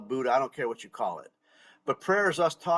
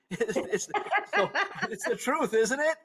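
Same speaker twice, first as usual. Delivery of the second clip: words broken up by high laughter; amused, laughing high; 10 semitones higher than usual. Real, not acted. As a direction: giggle through the punchline. Laughing at own TV joke.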